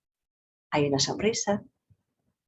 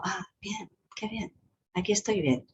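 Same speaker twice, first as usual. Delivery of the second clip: much softer, relaxed sigh of relief; relieved and calm; 2 semitones higher, 7 dB quieter than usual. Retold or imitated. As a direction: melt into comfort. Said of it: "ya me resulta como". (aliviado y tranquilo, casi susurrado)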